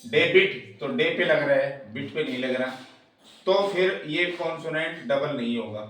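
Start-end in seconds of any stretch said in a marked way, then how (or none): 0.1-0.8 "debit" loud, with the weight on "डे"; loud, falling "debit" stressed on its first syllable. Shown as a demonstration. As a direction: hit the "डे" of "debit", let "बिट" fall. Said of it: "stress पहले syllable पर लग रहा है".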